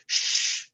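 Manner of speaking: unvoiced shush; hush. Tough guy silencing his partner, sharp shush.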